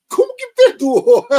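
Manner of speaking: high, loud, disbelieving cry; outraged high-pitched disbelief; incredulous. Real, not acted. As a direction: shriek it in disbelief. Incredulous at God's logic.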